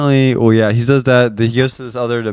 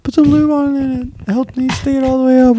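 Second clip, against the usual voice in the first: Rick quote; 13 semitones higher than usual. Retold or imitated. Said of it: "him saying".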